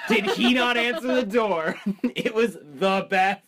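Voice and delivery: ordinary delivery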